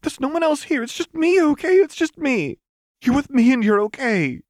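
high, loud pleading comfort; high-pitched, loud, urgent calming voice; desperate reassurance. Acted. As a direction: reassure her over the noise. Lux calming panicking Jinx.

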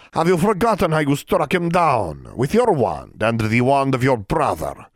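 a stern elder passing judgment; elf leader. Acted. Elf leader Tio accusing.

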